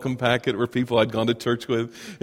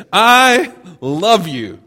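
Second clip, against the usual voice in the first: tender; 5 semitones higher, 5 dB louder, much slower than usual. Retold or imitated. Voicing Paul's letter.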